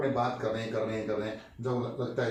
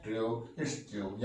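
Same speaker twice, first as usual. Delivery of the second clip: slow demo; 4 semitones lower, 6 dB quieter, much slower than usual. Shown as a demonstration. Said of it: "यानी कि पूरा बोलते हैं".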